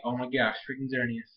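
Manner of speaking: low, soft aside; re-enacted underwhelmed reaction; unimpressed. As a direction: drop it under your breath. Own weary reaction to Xerneas.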